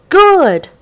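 high, loud glad shout; happy cheer. A narrator's reading. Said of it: "said the sailors".

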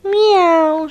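storybook cat mewing; cat meow. Acted. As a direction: mew like a cat stuck up a tree. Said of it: "she said".

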